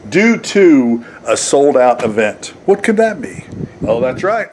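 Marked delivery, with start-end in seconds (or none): none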